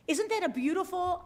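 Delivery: ordinary talk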